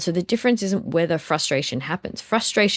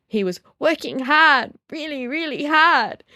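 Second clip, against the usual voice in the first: put-on copying toddler; 10 semitones higher, 6 dB louder, slower than usual. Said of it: "he began copying me".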